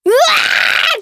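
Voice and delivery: a high, shrieking wail of loss; anguished scream. Retold or imitated. Imagining her strawberry eaten, she lets out a high wail. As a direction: wail as if your prize strawberry was stolen. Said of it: "って叫んじゃうと思います".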